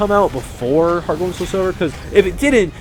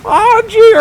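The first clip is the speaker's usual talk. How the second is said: much higher, louder squeaky Morty yelp; 9 dB louder than usual. Retold or imitated. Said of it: "I can't do a loud Morty voice".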